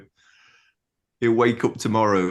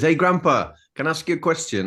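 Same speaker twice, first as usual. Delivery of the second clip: put-on a higher, eager little boy's question; lightly voiced child; 8 semitones higher, faster than usual. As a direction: play the curious grandson at bedtime. Grandson asking at bedtime.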